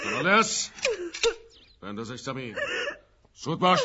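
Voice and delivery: high, loud, cracking order; tense command. Acted. Woman ordering the gunman to drop his gun, tense. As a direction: order him to drop it, shaking.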